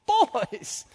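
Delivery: put-on high, loud old teacher calling out; imitating Miss Kenley. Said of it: "she said".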